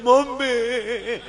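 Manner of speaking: hammed-up sobbing son-in-law, slow and sing-song; mock crying. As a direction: wail it like a comic crybaby. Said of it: "પણ કુમાર તમે રડો માં". (નાટકીય રડતો જમાઈ)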